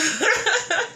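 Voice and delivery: a short high laugh through emotion; embarrassed laugh. Real, not acted. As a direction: laugh off the tears. Embarrassed at her tears.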